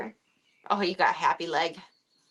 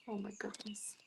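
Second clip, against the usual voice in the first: whispered, much softer; hushed aww; 16 dB quieter than usual. Real, not acted. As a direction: breathe it so the calm cat stays put. Kitten zenned out, hushed.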